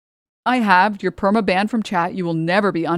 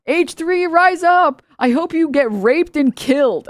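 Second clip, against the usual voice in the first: a high, mocking voice for the fans' threats; mocking hateful fans; 8 semitones higher, 4 dB louder, slower than usual. Streamer mocking her critic's fans, high and mocking. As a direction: sneer it out like a rabid fan would. Quoting hateful fan DMs.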